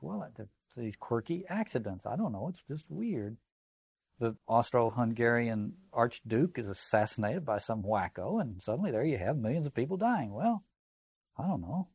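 soft, puzzled shrugging voice; mock baffled. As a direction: play a thinker who can't explain it. Voicing a baffled Enlightenment thinker.

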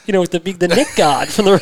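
usual speaking voice

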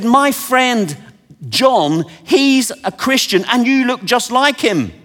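a hint of an excited stranger; man's earnest claim. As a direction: earnestly tell her she looks like your friend. The big man's explanation.

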